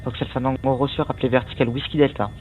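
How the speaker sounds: usual speaking voice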